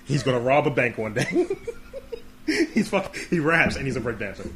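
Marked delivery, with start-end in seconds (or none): 1.5-2.7 shrill burst of laughter; high squealing laugh. Real, not acted. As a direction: let out a high cackle. Laughing at own joke.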